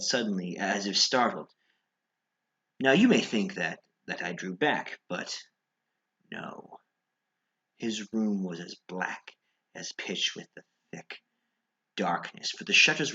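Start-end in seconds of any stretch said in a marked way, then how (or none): 6.3-6.8 whisper. Acted. Whispered denial.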